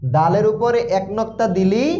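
ordinary talk, nothing marked

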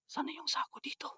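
unvoiced hushed whisper. Real, not acted. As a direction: whisper so nothing hears. Whispering while hunting a ghost.

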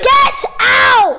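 loud, exasperated shout at a sibling; forceful order to leave; real yelling. Real, not acted. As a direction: yell it, totally fed up. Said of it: "Because you're yelling".

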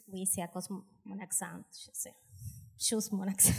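low, very soft aside; muttered aside. Acted. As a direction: trail into a private little excuse. Aside excusing her accent on stage.

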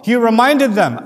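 normal talking voice

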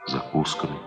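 usual speaking voice

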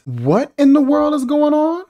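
high-pitched incredulous question; baffled outcry. Real, not acted. Critic reacting to a bizarre film scene, baffled. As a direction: exclaim in utter bewilderment.

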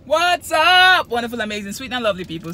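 loud, high, bubbly greeting; excited vlog intro. Real, not acted. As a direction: burst in to greet your viewers.

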